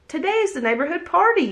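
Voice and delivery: high, quick chanted shout; excited group shout. A narrator's reading. The whole group shouting to Tess and Lottie. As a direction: rattle it off together, thrilled. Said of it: "shouted everyone".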